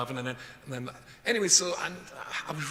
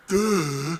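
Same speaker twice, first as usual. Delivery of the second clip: a louder, mocking American "duh"; 4 semitones higher, 10 dB louder than usual. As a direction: drop an exasperated American-style duh. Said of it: "As they say in America".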